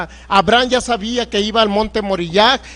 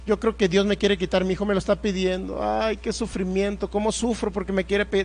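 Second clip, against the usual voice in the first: mock suffering; 7 dB quieter than usual. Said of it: "ideología de que".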